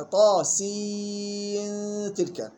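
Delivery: long chanted طاسين, nasal hum into تلك; hidden nun before ta. Shown as a demonstration. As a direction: draw out سين very slowly, hide its nun before تلك. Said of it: "وصلا بإخفاء النون عند التاء". (طاسين تلك بمدّ وإخفاء)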